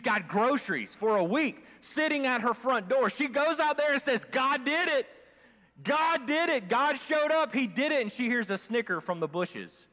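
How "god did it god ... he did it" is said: higher, rejoicing cry; joyful exclaim. Retold or imitated. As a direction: celebrate the answered prayer. Woman finds groceries, rejoicing.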